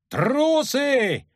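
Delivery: high, drawn-out furious cry; roar. A narrator's reading. Lyutik surprises everyone on the bridge, roaring. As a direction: roar the insult out long and high. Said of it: "рявкнул влезающий на мост Лютик".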